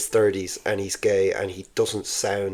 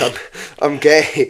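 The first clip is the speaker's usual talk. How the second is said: laughing, louder stab at Scouse; reluctant, higher Scouse imitation; half-hearted Scouse; 5 semitones higher, 5 dB louder than usual. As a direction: attempt a Scouse accent, breaking into a laugh. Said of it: "I'm not gonna do the Scouse voice".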